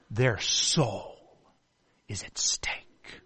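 hushed whisper, slow; solemn whisper. Real, not acted. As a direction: drop to a whisper for weight. Solemn point on the soul.

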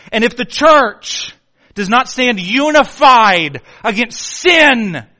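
fiery shouted sermon climax; shouted preaching. Real, not acted. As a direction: preach it at full force.

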